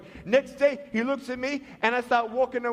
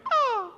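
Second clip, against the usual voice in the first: high-pitched little "ah"; imitated squeal; 5 dB louder than usual. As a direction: make a high mock squeal. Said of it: "And my foot went".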